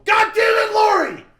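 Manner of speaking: high, full-volume exasperated shout; yelling dad. Retold or imitated. Imitating dad on air at mom, enraged shout. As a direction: blow up at the wife mid-game. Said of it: "My dad would yell at my mom".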